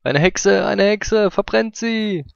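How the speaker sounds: high, loud hammed-up witch-hunt cry; mock mob cry. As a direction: shout like a medieval mob. (gespielter Pöbelruf)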